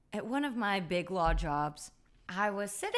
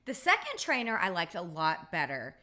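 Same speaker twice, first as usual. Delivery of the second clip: normal talking voice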